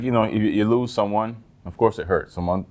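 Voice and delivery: ordinary delivery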